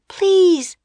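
very high, loud plea; Jack crying out. A narrator's reading. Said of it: "cried Jack".

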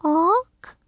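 put-on high, questioning baby raven squawk; small high croak rising like a question; hoarse bird cry. A narrator's reading. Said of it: "came a hoarse cry".

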